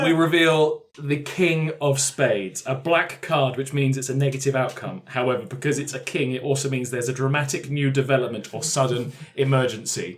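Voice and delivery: game master reading. Acted. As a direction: announce the card's fate gravely. Reads the drawn card.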